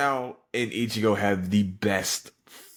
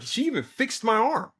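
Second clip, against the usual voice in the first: lightly voiced woman, pitched way up; high Yoruichi impression; 13 semitones higher than usual. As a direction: quote her in a high, amazed voice.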